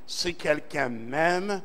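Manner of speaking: ordinary talk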